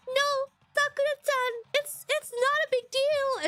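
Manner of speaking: put-on very high, drawn-out girly whine; hammed-up playing down, slow and squeaky; mock coy reassurance. Streamer imagining hurt girl telling Sakura, mock coy. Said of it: "She's like".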